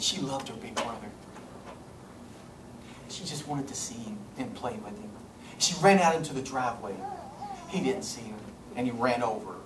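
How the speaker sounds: low, soft, grieving storytelling; hushed sorrow. Real, not acted. Preacher recounts a family's tragic loss, hushed and sorrowful. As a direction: drop low and quiet, weighed down by the tragedy.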